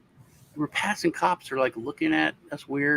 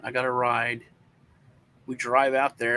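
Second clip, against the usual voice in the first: usual speaking voice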